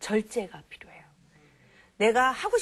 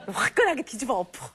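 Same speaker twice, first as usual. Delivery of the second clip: laughing; 3 semitones higher, 4 dB louder than usual. Shown as a demonstration. Mocks fiery temper, laughing.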